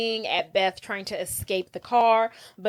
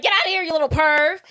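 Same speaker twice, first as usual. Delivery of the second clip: higher, louder, quick retold telling-off; mock indignant yell; 6 semitones higher, 5 dB louder, faster than usual. Girls shoo a peeping boy from the car, mock indignant yell. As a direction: chase him off in mock outrage, fast.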